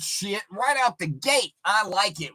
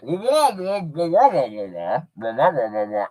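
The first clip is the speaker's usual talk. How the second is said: muffled wah wah; 3 semitones lower, 3 dB louder than usual. Retold or imitated. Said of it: "it would have sounded like".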